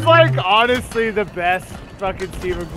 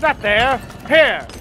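mock bossy yelling; bossy cartoon shouts; 5 semitones higher than usual. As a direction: order him about. Quoting commanding cartoon scene.

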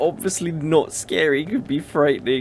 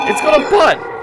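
high shrieking shout, stress on got; 11 dB louder than usual. Real, not acted. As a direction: shriek it in disbelief. Repeated as a shout.

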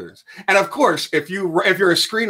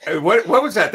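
usual speaking voice

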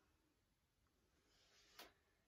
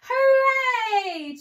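much higher, much louder hooray; cheering fish; 12 semitones higher, 13 dB louder than usual. Acted. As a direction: cheer like the whole school is saved. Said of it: "cheered the fish".

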